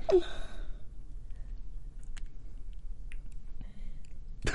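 breathy unvoiced laughing fit; silent laugh. Real, not acted. Breaks up after scene.

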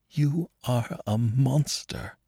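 high, soft and slow, accusing through tears; exclaimed. Acted. Lisa, weeping, accusing Herman in soft high disgust. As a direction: hurl the word monster in quiet disgust. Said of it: "exclaimed Lisa".

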